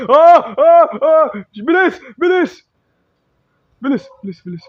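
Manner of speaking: very high, loud urgent cries; frantic screams of hurry; panicked screaming. Real, not acted. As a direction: scream to hurry while fleeing. Chase panic, rushing.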